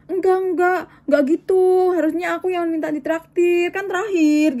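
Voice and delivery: imitated insistent, high-pitched objection; retold protest. Colleague objects to who should treat whom, retold insistent protest.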